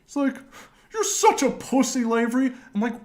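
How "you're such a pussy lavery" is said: high, loud taunting voice; mocking retold jeer. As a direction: imitate a friend jeering at him. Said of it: "he laughs at me".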